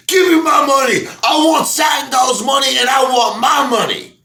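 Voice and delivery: high, loud mock demand; demanding shout. Pryor robbing the mafia office, loud high mock demand.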